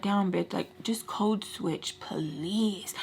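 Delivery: slow, pleading whisper; hushed, drawn-out exasperation; exasperated whisper. Retold or imitated. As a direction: whisper it as a private aside. Private aside judging friend's talk.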